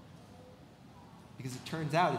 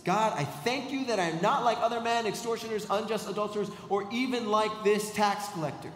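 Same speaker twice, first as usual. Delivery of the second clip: higher, louder, smugly superior prayer; smug self-righteous boasting; 7 semitones higher, 4 dB louder than usual. Shown as a demonstration. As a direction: boast loudly about being better than others. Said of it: "Look at this".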